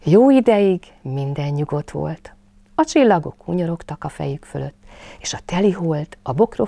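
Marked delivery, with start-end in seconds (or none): none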